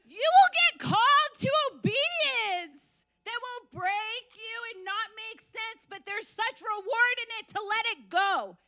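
high, loud, impassioned preaching. Real, not acted. Preacher urging listeners into obedience, impassioned. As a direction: drive it home slowly with force.

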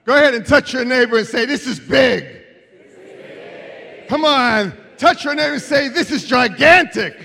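loud, high preaching shout; rallying preacher. Real, not acted. Preacher rousing congregation to touch neighbors, loud and high. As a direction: rouse the congregation, high and forceful.

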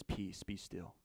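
low, soft and calm; understated command. Acted. Jesus calming a mighty storm, low quiet command. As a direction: speak it quietly, without effort. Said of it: "and he just says".

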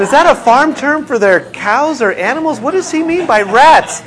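high, loud puzzled asking; baffled question. Retold or imitated. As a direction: ask it like a confused outsider. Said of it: "I said".

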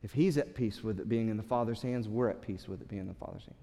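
soft, quiet voice of calm faith; peaceful trust. Pretend. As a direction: settle into it, at rest like him. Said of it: "and said".

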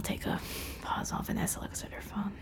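soft fast whisper. Real, not acted. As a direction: whisper it aside quickly. Whispered aside while waiting.

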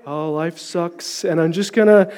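gloomy self-pity; mopey sigh. Retold or imitated. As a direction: sulk it out like a defeated kid. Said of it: "what I would have been doing".

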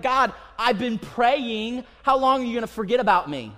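David pleading to God with a sarcastic edge; exasperated David. Retold or imitated. David complains to God that he is forgotten, exasperated. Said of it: "He's like".

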